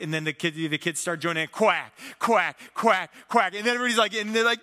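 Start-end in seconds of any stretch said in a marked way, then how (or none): 1.5-3.5 loud quacking chant. Retold or imitated. Kids join in, loud quacking chant. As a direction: chant the quacks louder like a whole team.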